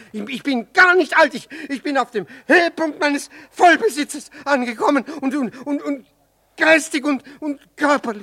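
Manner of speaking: loud, high flustered protest; indignant stammering protest; wounded pride. Acted. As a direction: defend his youth, loud and high. Insulted at being called old.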